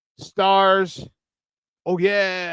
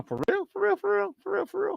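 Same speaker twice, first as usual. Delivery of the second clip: high squeaky voice; 14 semitones higher, 8 dB quieter than usual. Acted. Playful pleading to subscribe.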